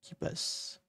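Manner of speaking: softly whispered name. Real, not acted. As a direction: breathe it out to yourself. Says tool name to himself.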